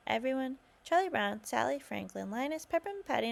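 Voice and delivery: ordinary delivery, nothing marked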